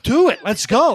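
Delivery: bored reporters shouting for a fight to start; egging on a fight. Retold or imitated. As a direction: rile them up like a bored crowd. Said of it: "the other half are like".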